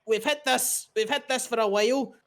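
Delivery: high, quick Irish shopkeeper; chirpy Irish sales pitch; Irish twang. Acted. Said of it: "it's like".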